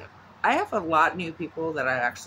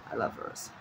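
breathy whisper to herself; 9 dB quieter than usual. Shown as a demonstration. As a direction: whisper it like a secret. Repeats phrase as a whisper.

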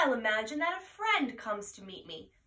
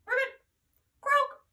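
high, squeaky froggy ribbit; frog croak voice; 12 semitones higher than usual. Pretend. Children's teacher croaking like a frog. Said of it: "imagine that I was a little froggy".

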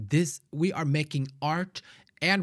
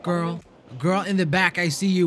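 high, louder playful call to the back; 5 semitones higher, 5 dB louder than usual. Real, not acted. Calling out to girl behind.

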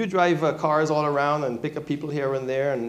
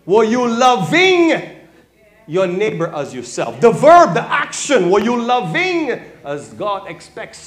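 challenging preaching shout; 8 semitones higher, 12 dB louder than usual. Real, not acted. Pastor challenging the congregation on loving neighbors, loud preaching. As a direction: press them hard on the question.

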